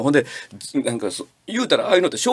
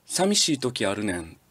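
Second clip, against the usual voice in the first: soft confession; 6 semitones lower, 7 dB quieter than usual. Shown as a demonstration. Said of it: "男の前で一言でいいんです".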